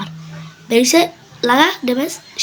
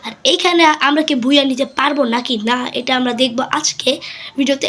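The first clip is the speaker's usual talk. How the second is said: ordinary delivery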